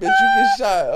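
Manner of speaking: high, loud squeaky yell; mock threat. Mock threat after cheating joke.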